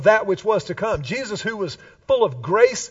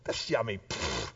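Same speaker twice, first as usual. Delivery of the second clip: low, quiet dismissive scoff; mock too-cool skeptic, pitched down; 11 semitones lower, 12 dB quieter than usual. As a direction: brush it off under your voice, ending in a pfft. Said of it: "our culture kinda mocks Sabbath".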